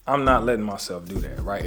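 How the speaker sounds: normal talking voice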